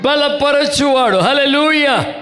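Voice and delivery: high, loud hallelujah cry; ringing preacher's shout; preaching shout. Acted. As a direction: lift it up and shout it out. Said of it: "preacher's climax with a shout".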